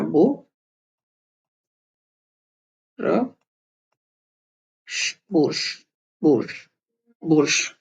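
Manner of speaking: बोर्श built up from बो and र to श, then said whole; syllable by syllable. Shown as a demonstration. Shows students how to build बोर्श, sounding it out slowly. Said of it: "जो श है यहाँ पे पूरा pronounce नहीं हुआ है".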